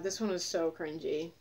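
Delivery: ordinary talk, nothing marked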